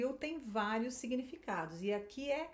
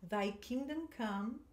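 slow, careful pronunciation; teacher modelling a short phrase; slow model line; slower than usual. Shown as a demonstration. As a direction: give it slowly to echo. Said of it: "Agora esse trechinho aqui".

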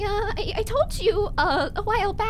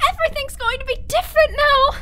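louder, faster, forced brightness; desperate cheer; 4 semitones higher, 5 dB louder, faster than usual. Acted. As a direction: insist on hope against fear. Desperate hope.

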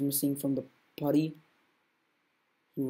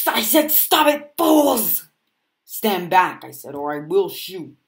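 high, loud order to stop; commanding shout; 4 semitones higher, 9 dB louder, slower than usual. Acted. Armed officer shouts at the fighters to stop, loud. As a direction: bark orders with a gun drawn.

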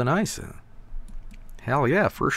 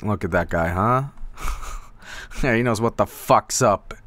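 ordinary talk, nothing marked

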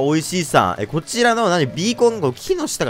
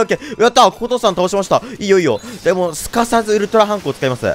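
normal talking voice

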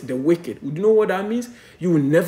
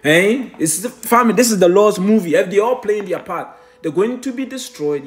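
raised, louder pulpit voice; fiery preaching; 5 semitones higher, 5 dB louder than usual. Acted. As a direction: preach it hard to the congregation. Preaching at full force.

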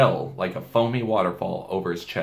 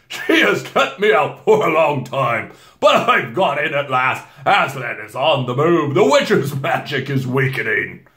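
solemn Father Christmas; 5 semitones higher, 8 dB louder than usual. A narrator's reading. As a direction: proclaim the good news grandly.

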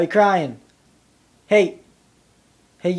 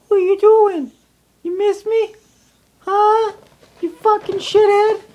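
much higher, playful jeering at the dog; high playful taunting; teasing high voice; 10 semitones higher, 3 dB louder than usual. Real, not acted.

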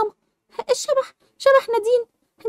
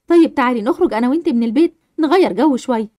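lower, soothing mum voice; calm the frightened child down; comforting mother; 10 semitones lower than usual. Acted. Mother soothing scared Sara, lower reassuring voice.

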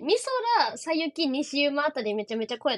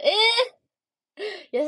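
loud, high "えー!" of delight; sudden loud squeal of surprise; surprised cry; 7 semitones higher, 7 dB louder than usual. Real, not acted. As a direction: gasp out a big happy えー. Reacting to fan's kindness.

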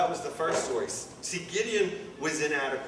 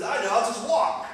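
higher, louder acted-out decision; mock bright idea; 9 semitones higher, 6 dB louder than usual. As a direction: voice a sudden idea, pitched up and loud. Voicing Jesus's sudden idea in retelling.

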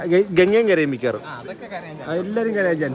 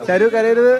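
louder call across the crowd; 3 semitones higher, 6 dB louder, slower than usual. Real, not acted. Calling after the departing bride not to cry, loud call-out. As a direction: shout it after the leaving car.

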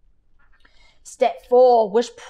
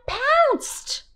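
leaping pounce. A narrator's reading.

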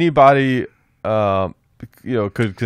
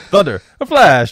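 a hammed-up Italian accent, higher and louder; 8 semitones higher, 5 dB louder than usual. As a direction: play a mock Italian sentry giving the password. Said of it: "If it's like the Italians".

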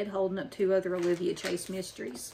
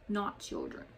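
usual speaking voice

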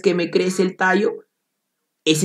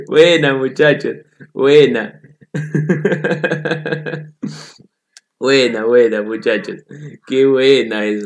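louder praise through laughter; laughing praise; 3 semitones lower, 3 dB louder than usual. Real, not acted. Teacher praising a student's joke, laughing.

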